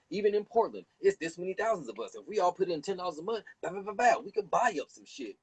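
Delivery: high, fast, pumped-up organizer; rallying pitch. Retold or imitated. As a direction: fire up the crowd with the money plan. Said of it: "we'll get together and say".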